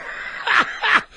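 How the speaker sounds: high shrieking laugh. Real, not acted. Laughs at own joke.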